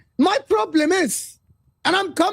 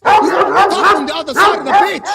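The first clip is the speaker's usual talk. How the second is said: mocking loud jab; 2 semitones higher, 8 dB louder than usual. Real, not acted. Mocking tough defenders.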